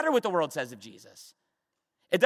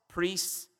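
ordinary delivery

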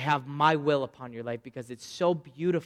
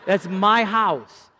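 That's MY house stretched very slowly, higher and louder; drawn-out mock gloating; 7 semitones higher, 10 dB louder, much slower than usual. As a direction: savour every word of That's MY house. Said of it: "I'm like".

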